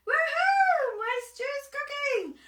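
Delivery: high, loud, laughing whoop; excited whoop. Acted. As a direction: burst out with giddy glee. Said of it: "he danced and sang".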